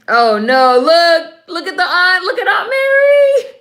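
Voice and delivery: building from loud to a very high shouted cry; excited shout. Real, not acted. Viewer shrieking at the aunt's reaction on screen, rising to an excited shout. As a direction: climb to a shriek of delight.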